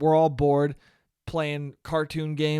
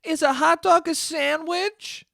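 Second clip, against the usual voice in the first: high, drawn-out mocking question; mocking questioner; 11 semitones higher, slower than usual. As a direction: parody the asker with a soaring pitch. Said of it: "where they go".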